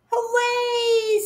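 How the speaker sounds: put-on high, bright child's cheer; delighted little cheer. A narrator's reading. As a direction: squeal it with delight.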